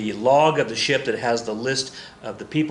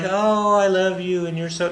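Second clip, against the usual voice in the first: hammed-up lovestruck lady, higher and louder; gushing lady; 6 semitones higher, 4 dB louder than usual. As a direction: coo it high, overdoing the adoration. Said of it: "and she's like".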